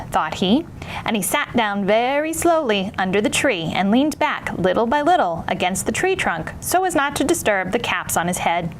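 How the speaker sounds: usual speaking voice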